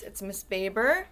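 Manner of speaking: ordinary talk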